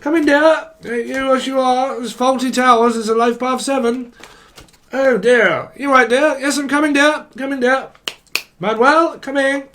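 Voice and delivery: high, put-on sing-song character voice; comic dotty character. Comic caller offering a bone on the phone, high put-on voice. Plays Fawlty Towers style scene.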